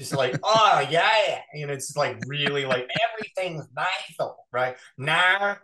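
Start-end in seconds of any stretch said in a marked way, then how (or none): 0.4-1.4 hammed-up Australian twang, pitched higher and louder; a cheesy Paul Hogan ad Australian voice; bad Australian accent. Joking to the host about his Kano voice, hammed-up Australian accent. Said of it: "like a really bad Paul Hogan commercial". 3.1-5.6 a cheesy Paul Hogan ad Australian voice; bad Australian accent. Joking to the host about his Kano voice, hammed-up Australian accent. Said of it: "like a really bad Paul Hogan commercial".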